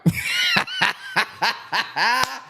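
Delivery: high, whooping burst of laughter. Real, not acted. Laughing at his own bit.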